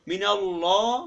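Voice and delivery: slow "মিনাল্লাহ" with a thick, long "llaw"; rounded heavy lam. Shown as a demonstration. Teacher spelling it out for learners, rounded heavy "ল্লা" in মিনাল্লাহ. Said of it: "মুখটা গোলাকার করে পড়তে হবে".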